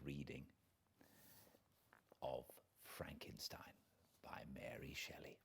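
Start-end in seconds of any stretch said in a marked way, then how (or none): none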